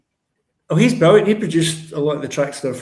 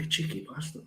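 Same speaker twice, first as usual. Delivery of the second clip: muttered aside; 11 dB quieter than usual. Retold or imitated. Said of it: "And I was like".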